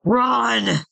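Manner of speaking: high, drawn-out yell; yelled command. A narrator's reading. Narrator warns friends of charging spirit, yelled. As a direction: yell it to get everyone moving. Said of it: "I yelled".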